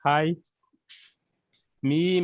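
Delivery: usual speaking voice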